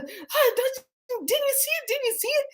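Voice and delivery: very high, urgent squeal; mock panic. Wife urging husband to see it, mock-panicked squeak. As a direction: squeak it in fake alarm. Acts out wife pressing the prank.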